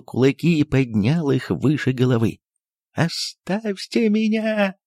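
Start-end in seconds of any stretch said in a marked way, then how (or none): 2.9-4.7 high, slow, strained cry of leave me; cry in a strange voice. A narrator's reading. The doctor cries at his guests in a strange, high, strained voice. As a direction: cry out in a voice not his own. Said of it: "крикнул он не своим голосом".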